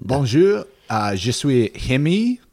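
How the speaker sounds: hammed-up French greeting, slow; mock French accent. Joking spy introduces himself at the party, mock French accent.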